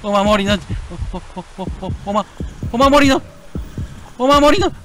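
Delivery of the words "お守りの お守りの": higher, louder stammered lead-in; building boast. Acted. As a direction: wind up for the big line.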